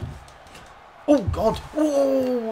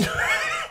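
squealing laugh; 6 dB quieter than usual. Real, not acted. Surprise popup in game.

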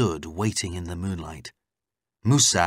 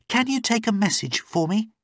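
very high, urgent request; high female character voice, asking for help; pleading captive woman; 13 semitones higher than usual. Acted. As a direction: appeal through the locked door. Captive asks a favour.

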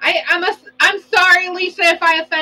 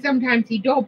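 ordinary talk, nothing marked

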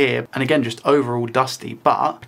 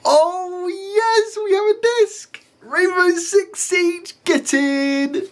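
real excited squeal; much slower than usual. Real, not acted. Disc unexpectedly loads, celebrating.

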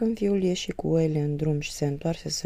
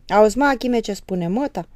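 loud, scornful outburst; father mocking the mother's request, loud and furious; 6 semitones higher, 9 dB louder than usual. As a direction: bark at the son in anger. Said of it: "cu un glas furios".

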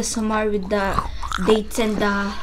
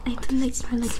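whispering; 5 dB quieter than usual. Real, not acted. Whispering during ASMR recording.

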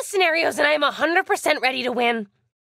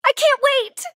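high-pitched eager exclamation; eager excitement; 8 semitones higher than usual. Acted. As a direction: burst out with eager anticipation. Game character eager to start.

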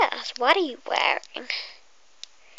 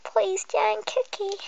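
pleading; 7 semitones higher, slower than usual. Acted. Begging customer to stay.

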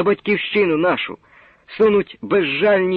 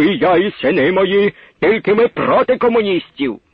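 heated, loud, raised voice in a quarrel; angry shouting old man; 4 semitones higher, 5 dB louder than usual. Acted. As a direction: shout him down, furious. Quarrel between old men.

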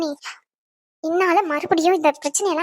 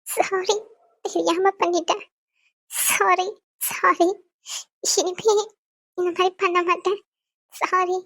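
tearful apology; much slower than usual. Acted. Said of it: "நான் அழுதுட்டு இருக்கேன்". (கணவனிடம் அழுதபடி மென்மையான மன்னிப்பு)

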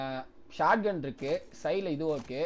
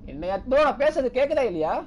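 loud, high-pitched check on being heard; real exasperated shout to be heard; speaking up loudly; 6 semitones higher, 6 dB louder than usual. Real, not acted. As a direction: raise your voice to the listeners. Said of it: "இதுக்கு மேல எப்படியா சத்தமா பேசுறது".

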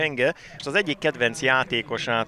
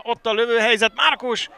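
excited commentary; 11 semitones higher, 5 dB louder than usual. Real, not acted. Late chance called live.

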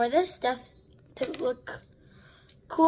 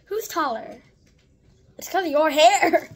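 ordinary delivery

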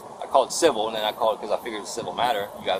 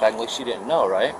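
ordinary talk

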